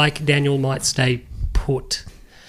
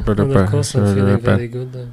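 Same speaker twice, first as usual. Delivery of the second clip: lower, flat-sounding Kimi; deadpan Kimi voice, a bit lower; Kimi impression; 4 semitones lower, 4 dB louder than usual. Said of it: "That's Kimi".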